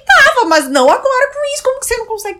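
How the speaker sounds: high, loud exasperated telling-off; exasperated outburst. Retold or imitated. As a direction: scold him, worked up and shrill. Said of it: "Eu falei". (bronca exasperada, alta e aguda)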